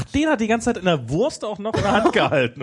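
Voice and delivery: high-pitched, laughing astonishment; amused disbelief. Real, not acted. Co-host amazed the coin survived the sausage, incredulous. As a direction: blurt it out, pitched right up, with a laugh.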